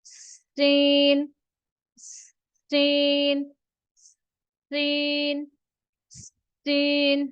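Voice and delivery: teacher models a whistling "सीन"; whistled s demo. Shown as a demonstration. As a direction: hold the hiss in "सीन" like a whistle. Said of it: "सीन में सीटी की आवाज आनी चाहिए".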